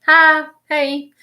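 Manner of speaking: bright, high, eager hellos; loving greeting. Retold or imitated. Spirit warmly greets family in a lightly voiced high hello. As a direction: greet them like a loving old friend. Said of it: "very loving guy".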